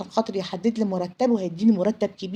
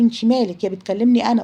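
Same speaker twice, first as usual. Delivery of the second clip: louder, confrontational comeback; indignant retort; 2 semitones higher, 7 dB louder than usual. Acted. Café owner telling the friend to butt out, indignant. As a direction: push back loudly at someone meddling.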